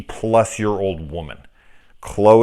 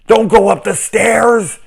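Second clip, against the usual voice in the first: high, loud, slow shout of warning at the screen; warning horror victim; 14 semitones higher, 9 dB louder than usual. Retold or imitated. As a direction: yell at a horror heroine in alarm. Horror audience warning a character.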